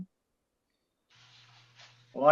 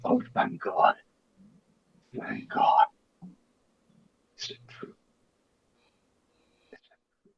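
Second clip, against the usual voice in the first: whisper; 4 semitones higher, 5 dB quieter, faster than usual. Acted. Hears of Antonio's loss.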